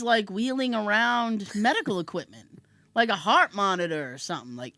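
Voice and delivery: usual speaking voice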